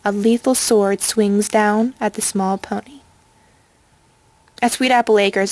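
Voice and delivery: usual speaking voice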